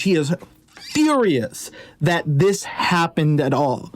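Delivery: ordinary delivery, nothing marked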